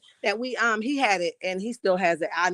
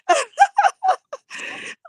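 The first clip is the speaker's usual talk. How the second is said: a squealing cackle; high cackling laugh. Real, not acted. Laughing at her own joke.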